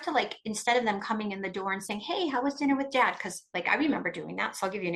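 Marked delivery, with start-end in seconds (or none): 2.0-3.2 overly nice. Retold or imitated. Cheerful parent greeting child.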